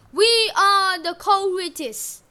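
loud, slow warrior's bellow; full-lung shout. A narrator's reading. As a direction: shout it out at the top of your lungs. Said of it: "shouted at the top of his lungs".

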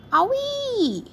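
high, sharp yelp of pain. A narrator's reading. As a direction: squeal as the cactus jabs. Queen bee poked by cactus.

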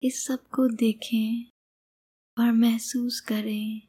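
calm meditation guide, long pauses between phrases; guided meditation. Acted. Meditation guide leads listener on an imagined sky journey, calm and unhurried. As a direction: guide the listener gently, leaving space after each phrase.

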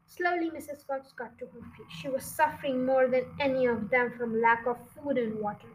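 plain reading voice

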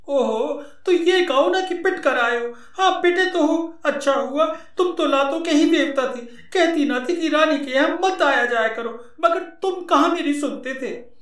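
high woman's voice, taunting; mocking wife voice. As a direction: needle him as the scolding wife. Wife mocks beaten husband.

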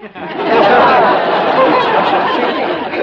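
high, loud triumphant cackle; hammed-up swindler laugh; gleeful cackle. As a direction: cackle at the mark paying up. Con man laughs after naming fee.

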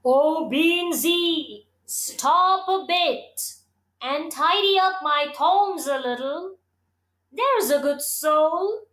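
pleading plum tree. A narrator's reading. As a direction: draw it out, begging kindly. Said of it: "it cried out".

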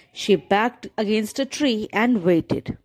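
usual speaking voice

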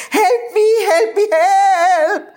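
very high, crying, drawn-out plea; panicked cry for help. A narrator's reading. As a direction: scream for help in a dream. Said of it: "I shouted".

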